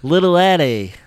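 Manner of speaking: loud, high mock commentator; pod racing announcer. Co-host joining the pod race bit, loud announcer voice. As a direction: shout the racer's name like an announcer.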